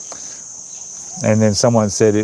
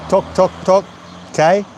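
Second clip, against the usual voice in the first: gecko call imitation; 8 semitones higher than usual. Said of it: "It goes".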